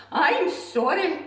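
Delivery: high, loud, slow frightened splutter; spluttering dragon. Acted. Scared dragon apologising to the beast, high and spluttering. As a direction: stammer it out in fright. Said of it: "he spluttered".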